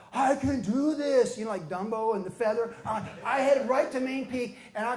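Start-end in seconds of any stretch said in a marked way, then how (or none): 0.1-1.4 slow, louder and higher; slow, swelling self-belief; triumphant. Retold or imitated. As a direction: say it like you've just believed it. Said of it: "I'm like".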